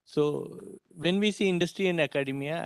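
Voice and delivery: usual speaking voice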